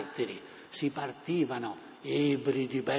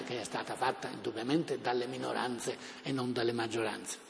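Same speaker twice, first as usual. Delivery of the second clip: ordinary talk, nothing marked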